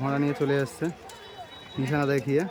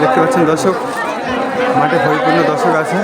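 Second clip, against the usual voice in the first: loud announcer voice, louder; race commentary; 7 semitones higher, 11 dB louder than usual. Acted. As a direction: call it to the crowd. Opening horse race commentary. (ঘোড়দৌড়ের ধারাভাষ্য)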